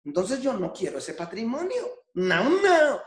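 quoted refusal building to a high, loud "no"; emphatic refusal. Retold or imitated. Speaker quotes a mentor to the audience, emphatic rising refusal.

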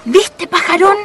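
ordinary talk, nothing marked

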